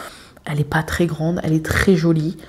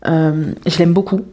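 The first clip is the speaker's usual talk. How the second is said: normal talking voice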